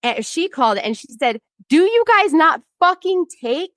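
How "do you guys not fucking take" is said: high-pitched, imitated outrage; retold indignant demand. Therapist confronting the complaints department, retold indignant demand. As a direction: challenge them in mock outrage.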